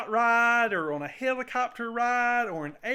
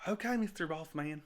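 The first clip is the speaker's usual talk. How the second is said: flat; 7 semitones lower, 10 dB quieter than usual. Retold or imitated. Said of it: "And I was like".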